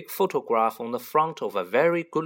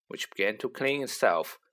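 ordinary delivery

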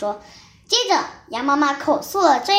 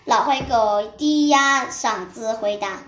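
ordinary delivery, nothing marked

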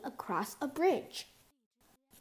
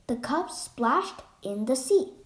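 ordinary delivery, nothing marked

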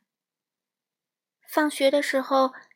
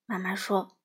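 ordinary delivery, nothing marked

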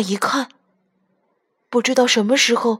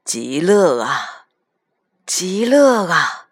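put-on very slow, savoured old man's words; blissful grandpa; much slower than usual. A narrator's reading. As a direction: stretch each word out in contentment. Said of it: "双手合十说".

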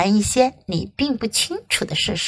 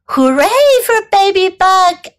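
cheering crowd; 7 semitones higher, 4 dB louder than usual. A narrator's reading. Everyone celebrates baby bug, cheering. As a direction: cheer for the little hero. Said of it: "everyone cried".

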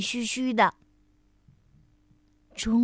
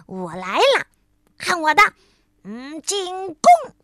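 loud, higher playful charge cry; battle call; 4 semitones higher, 6 dB louder than usual. A narrator's reading. Frog charging into a snowball fight, playful battle yell. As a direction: yell out the attack in play. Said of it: "跳跳叫着".

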